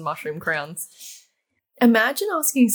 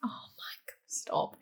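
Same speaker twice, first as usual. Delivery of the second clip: embarrassed breathless whisper; 14 dB quieter, slower than usual. Real, not acted. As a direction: whisper it, mortified. Embarrassed reaction to quote.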